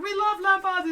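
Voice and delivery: high, very loud mock cheer; hammed-up gleeful shout; imitated excited shouting. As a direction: yell it like an overexcited crowd. Acted quote of the raiders.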